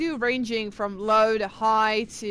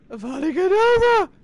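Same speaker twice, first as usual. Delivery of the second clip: villain's spell cry; 9 semitones higher, 10 dB louder than usual. Acted. Fan imitating a favourite film moment, shouted spell. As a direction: hurl the spell out in a high, strained shout. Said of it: "I can't really do his voice".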